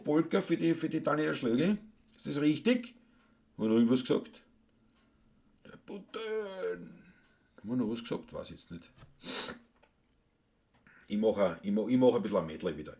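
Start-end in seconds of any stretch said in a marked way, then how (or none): 5.6-7.0 high, soft, very slow; soft high sing-out; drawn-out aside. Pretend. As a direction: stretch it out softly up high. Drawn-out high aside between requests.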